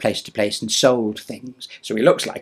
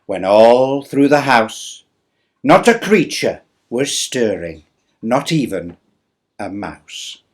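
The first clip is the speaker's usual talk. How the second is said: slow, louder storyteller reading; reading a poem aloud; 10 dB louder, much slower than usual. Acted. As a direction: read it like a Christmas tale to children.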